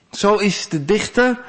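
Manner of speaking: normal talking voice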